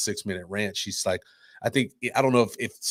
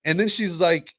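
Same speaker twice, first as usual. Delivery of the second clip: usual speaking voice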